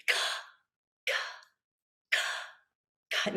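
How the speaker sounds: short k sounds gasped on the exhale. Shown as a demonstration. Teacher showing the class a gasped k sound. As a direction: flick a k off the diaphragm. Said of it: "Keep your hand on your diaphragm".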